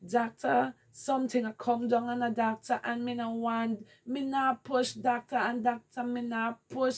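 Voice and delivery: high pitched pleading cry in patois; screaming. Retold or imitated. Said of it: "I remember screaming".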